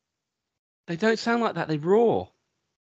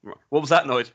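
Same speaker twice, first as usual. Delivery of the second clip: usual speaking voice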